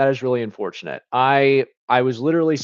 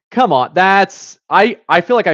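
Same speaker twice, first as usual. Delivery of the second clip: frustrated; 6 semitones higher, 7 dB louder than usual. Real, not acted. Missed an easy answer.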